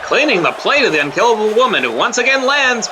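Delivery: ringside play-by-play; wrestling commentator. Acted. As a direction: call the action like a wrestling broadcast.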